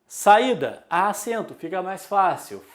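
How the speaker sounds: ordinary talk, nothing marked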